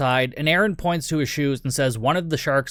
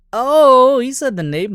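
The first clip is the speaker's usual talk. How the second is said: surprised; 10 semitones higher, 8 dB louder than usual. Real, not acted. Hears the movie title.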